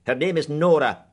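curt and sharp. Acted. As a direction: snap out the name. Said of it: "said Missus Bingham sharply".

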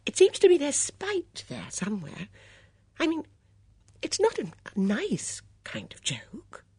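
put-on high, fluttery, worried old lady; a fussy old companion fretting; anxious old Dora. Dora frets to Miss Blacklock about the notice, high and anxious.

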